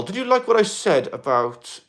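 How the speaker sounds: ordinary talk, nothing marked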